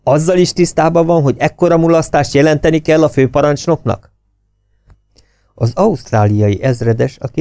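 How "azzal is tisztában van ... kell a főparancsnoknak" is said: high, loud accusing question; angry threat. A narrator's reading. Officer threatening to report the blunder to the commander. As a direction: press him hard with the threat.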